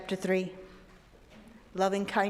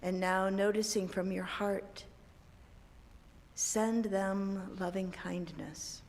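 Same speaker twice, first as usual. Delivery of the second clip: guided meditation; 7 dB quieter than usual. Acted. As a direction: guide them gently into calm. Leads a meditation.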